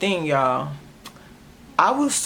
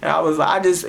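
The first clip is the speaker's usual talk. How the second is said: ordinary delivery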